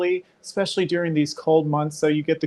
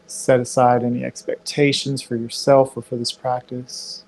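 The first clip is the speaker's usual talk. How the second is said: guided meditation; 2 semitones lower than usual. Acted. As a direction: guide the breath calmly, unhurried. Leads guided yoga breathing.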